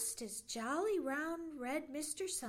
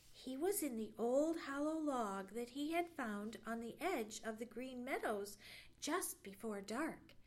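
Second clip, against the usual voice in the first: ordinary delivery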